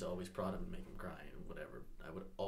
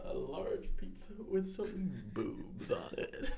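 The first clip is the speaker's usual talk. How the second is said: louder, slower repeat; 10 semitones higher, 3 dB louder, much slower than usual. Retold or imitated. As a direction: spell it out slow and high for a listener who missed it. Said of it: "You have to speak up a little bit".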